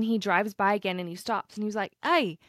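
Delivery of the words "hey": camper's accent put on, pitched up and louder; imitated British accent. Camper greets them again while packing up, imitated British accent. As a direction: do the English traveller's friendly accent. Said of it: "he has an accent".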